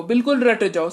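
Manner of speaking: ordinary talk, nothing marked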